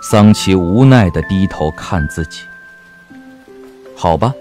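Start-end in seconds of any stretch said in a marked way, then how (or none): none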